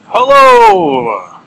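high, loud, long-held hello; big drawn-out greeting. Pretend. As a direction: sing out a hammed-up show opener. Drawn-out show-opening greeting.

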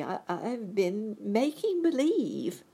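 high, slow, hesitant admission; meek confession. A narrator's reading. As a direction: confess meekly, with a stammer. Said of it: "said the little man meekly".

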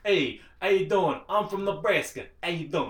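loud, high hammed-up Brooklyn guy; Brooklyn accent. Co-host jokingly does a Brooklyn greeting, loud and hammed-up. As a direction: play a brash New Yorker saying hey. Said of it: "sinking into the Brooklyn one".

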